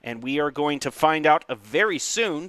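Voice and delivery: ordinary talk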